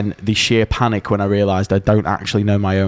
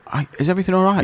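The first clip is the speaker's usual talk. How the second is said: much higher, sweet concerned neighbour; innocent helpful passer-by; 9 semitones higher, much faster than usual. Pretend. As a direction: act overly innocent to fool the shop. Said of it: "but as themselves".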